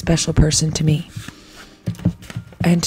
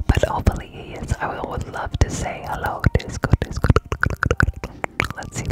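ear cleaning asmr; 4 dB quieter than usual. Real, not acted.

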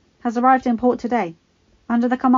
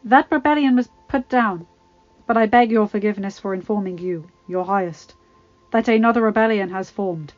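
trembling Baelish; 8 dB louder than usual. Acted. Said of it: "Trembling, Baelish nodded".

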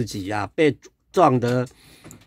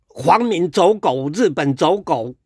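jeering insult; 4 semitones higher than usual. Retold or imitated. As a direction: spit the insult down at him. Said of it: "说".

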